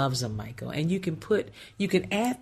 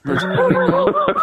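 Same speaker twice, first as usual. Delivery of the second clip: much higher and louder, laughing; teasing; 9 dB louder than usual. Acted. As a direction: tease it out, squealing with laughter. Teasing repeat to co-host.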